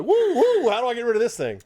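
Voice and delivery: high, startled squeal; horse's voice. Pretend. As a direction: play a panicked horse. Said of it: "That horse is gonna be like".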